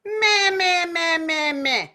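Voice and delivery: high, loud nasal meh falling. Shown as a demonstration. Teacher demonstrating nasal meh for the class. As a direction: push meh through the nose.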